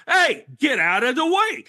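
loud, high imitated New York holler; mock shout. New Yorker to phone-gazer blocking the sidewalk, mock angry shout. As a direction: yell it like an annoyed passerby, hammed up.

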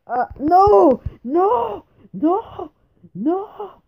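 very high, loud repeated cries; anguished wailing. Real, not acted. Player losing right after success, high wailing shouts. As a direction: wail each one in disbelief.

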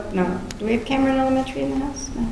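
normal talking voice

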